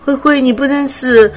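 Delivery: the cub calling to his dying friend, desperate and loud; desperate crying out. Acted. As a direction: beg her not to die, calling out. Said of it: "她不断喊着".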